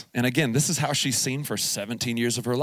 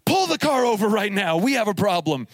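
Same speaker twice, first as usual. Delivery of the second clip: higher, urgent command to pull over; alarmed order; 8 semitones higher, 3 dB louder than usual. Retold or imitated. Dad ordering his daughter to pull the car over, alarmed. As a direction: stop this right now, half-panicked.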